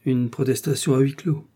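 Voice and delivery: plain reading voice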